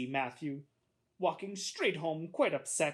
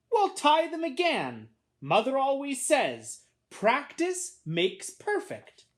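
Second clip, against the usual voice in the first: high, slow, lecturing sister; bossy big sister; 12 semitones higher, 7 dB louder, slower than usual. A narrator's reading. Sister quoting Mother to her brother, bossy and high. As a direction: preach Mother's rule at a little brother, slowly.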